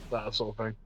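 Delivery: usual speaking voice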